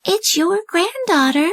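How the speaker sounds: high, slow put-on girlish voice; the wolf sweetly pretending to be the girl; wolf disguising his voice as the little girl; wolf faking a girl.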